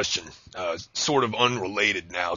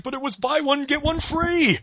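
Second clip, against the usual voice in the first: very high, hammed-up promo announcer; 4 dB louder than usual. Host spins bad pizzas to his date, announcer voice. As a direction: sell it like an over-the-top ad spin. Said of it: "I love your announcer voice".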